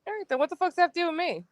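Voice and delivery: high, fast, clipped off; exasperated dismissal. Real, not acted. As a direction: brush him off quickly. Dismissing him in an argument.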